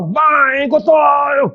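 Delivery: high, hammed-up scolding shout; mock angry yell; scolding mother. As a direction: imitate a wife telling off a child. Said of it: "Anak dia marah".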